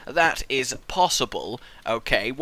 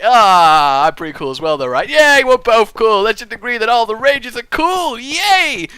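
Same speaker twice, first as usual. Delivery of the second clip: louder, bouncy agreement; cheerful reply; 4 semitones higher, 8 dB louder than usual. Retold or imitated. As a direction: answer yourself happily. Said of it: "And I'm like".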